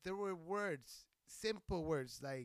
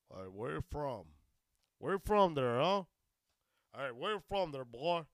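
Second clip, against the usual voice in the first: throaty put-on interrogator with punched words; phlegmy mock accusation; 3 semitones lower, 7 dB louder than usual. Shown as a demonstration. Comedian redoing a stranger's challenge properly, phlegmy mock accusation. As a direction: imitate a suspicious questioner through phlegm. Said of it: "phlegm it up".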